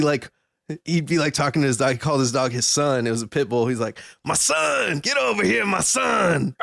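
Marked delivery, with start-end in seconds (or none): 4.2-6.6 yelling at his dog. Retold or imitated. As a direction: holler for the dog like a trailer-park owner.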